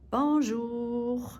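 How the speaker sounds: bonjour with a long, held "jour"; stretched-out jour. Shown as a demonstration. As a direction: stretch out the last syllable of bonjour. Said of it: "stretch out the jour".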